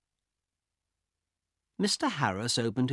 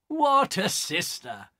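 slow, louder mocking sneer; scornful brother; 8 semitones higher, 4 dB louder, slower than usual. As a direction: laugh off her threat. Carl laughs at her threat.

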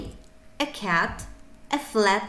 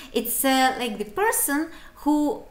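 ordinary talk, nothing marked

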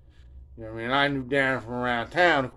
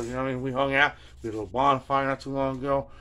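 normal talking voice

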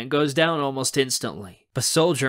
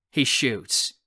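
ordinary delivery

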